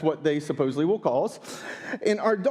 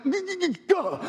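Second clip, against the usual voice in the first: flustered stammer; 11 semitones higher than usual. Retold or imitated. Dad stammering in a rush.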